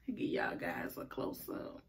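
soft whispered aside; whispering. Real, not acted. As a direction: whisper it to the camera.

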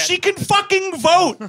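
high, loud, drawn-out protest; outraged outburst. Acted. Comic objecting to dad babying his daughter, outraged. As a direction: yell it slowly in comic outrage.

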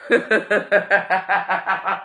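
calm down breaking into a laugh; can't hold back the laugh. Real, not acted. Laughing at chat.

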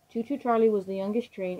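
ordinary delivery, nothing marked

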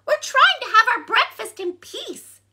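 very high, loud tiny-bug voice; squeaky ladybug. A narrator's reading. Ladybug wanting breakfast in peace, squeaky. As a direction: squeak out the complaint. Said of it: "squeaked the ladybug".

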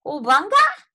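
loud, high little squeal. A narrator's reading. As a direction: squeal like a tiny tadpole. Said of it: "squealed Polly Wogglina".

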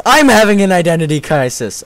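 high, loud cry on I'M; mock outburst. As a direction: exclaim it, hitting "I'm" hard. Exclaims the comment about self.

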